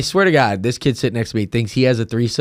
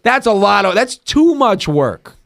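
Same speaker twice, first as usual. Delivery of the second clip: exasperated rant; 6 semitones higher than usual. Real, not acted. Exasperated complaint.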